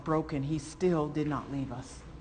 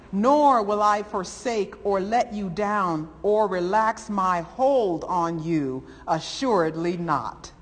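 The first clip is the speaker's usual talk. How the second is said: preaching at full force; 3 semitones higher, 4 dB louder than usual. Acted. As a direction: ring every promise out over the congregation. Reads scripture at full force.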